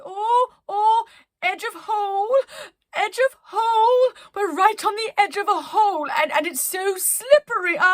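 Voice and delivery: high, loud, frantic voice; teetering on a slippery edge; panicky. Acted. Penelope panics on the edge of a hole, high and frantic. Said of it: "Penelope continued with".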